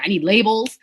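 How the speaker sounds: loud, rushed, rising overwhelm; frantic. Retold or imitated. Starting a business, asking her designer husband, frantic. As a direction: blurt the list in a mock panic.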